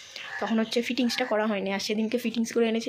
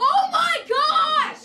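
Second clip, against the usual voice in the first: high, loud drawn-out exclamation; surprised shout; 6 dB louder, much slower than usual. Real, not acted. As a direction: gasp it out in shock. Surprised at own hair look.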